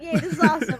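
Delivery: high, loud little cheer; gleeful put-on yay; mock delight. Acting delight in cozy bed.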